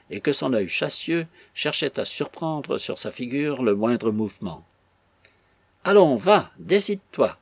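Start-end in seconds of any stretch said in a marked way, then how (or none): 5.8-7.4 loud, high, impatient prodding; urging. A narrator's reading. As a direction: goad him into answering. Interrogator urging Robin to talk. (exhortation forte et impatiente)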